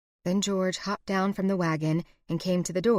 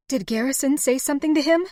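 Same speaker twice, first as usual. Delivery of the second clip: high, louder urgent question; 8 semitones higher, 5 dB louder, faster than usual. A narrator's reading. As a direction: push him for the truth. Heroine refuses to back down.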